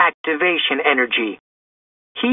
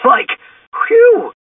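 very high sigh of relief; high goofy Shaggy voice, relieved; relieved cartoon slacker; 5 dB louder than usual. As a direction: let out a squeaky, relieved whew.

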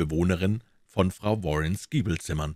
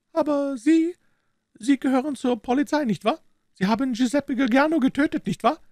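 high, far-off voice of an anxious Italian woman; 4 dB louder than usual. A narrator's reading. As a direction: ask tensely, as a frightened Italian wife. (ängstliche Italienerin, hohe Stimme)

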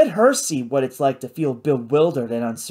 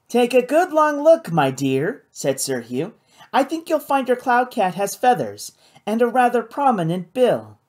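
lightly voiced pompous gentleman, raised pitch; condescending Sir Hugh; 7 semitones higher than usual. A narrator's reading. Sir Hugh corrects Kate about her cloud cat, condescending.

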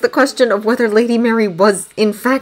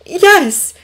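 high, loud, hurried yes; quick reply; 11 semitones higher, 6 dB louder than usual. A narrator's reading. Daughter reassures her mother, quick reply. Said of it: "she said quickly".

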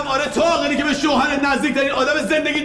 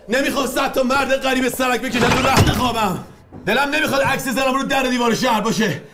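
ordinary talk, nothing marked

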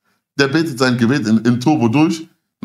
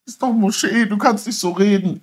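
mocking high voice; 8 semitones higher, slower than usual. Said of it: "Der so".

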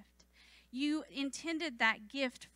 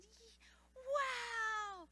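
put-on high squeaky toddler wow; child's awe; 10 semitones higher, 6 dB quieter than usual. Toddler gazing at the night sky, high childlike wonder. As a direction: do the little boy amazed. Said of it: "And he said".

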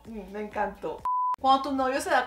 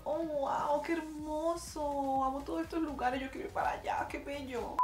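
dreamy hush; 4 semitones higher, 8 dB quieter than usual. Real, not acted. Dreamy over Italian summer.

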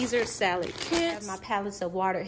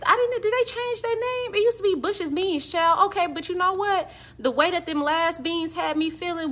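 high, loud surprised exclamation; 8 semitones higher, 4 dB louder than usual. Real, not acted. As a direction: squeal it out in disbelief. Surprised at the renamed beans.

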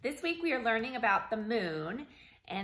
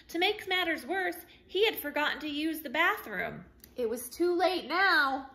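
higher, louder building fret; mock dismay; 6 semitones higher, 4 dB louder than usual. A narrator's reading. Narrator to kids about the forgotten bathroom, mock alarm. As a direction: play up the little disaster. Jake's nervous predicament.

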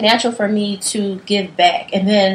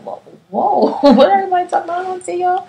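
overwhelmed surprise; 9 semitones higher than usual. Retold or imitated. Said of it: "I was like".

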